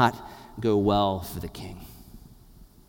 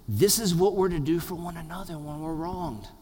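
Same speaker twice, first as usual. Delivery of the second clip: ordinary talk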